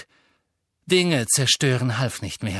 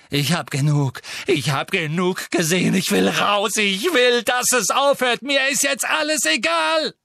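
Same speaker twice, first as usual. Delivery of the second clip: louder and higher, building to a scream; raging shouts; 10 semitones higher, 6 dB louder than usual. A narrator's reading. Harry rages at Dumbledore, building to a scream. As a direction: rant faster and higher till it breaks.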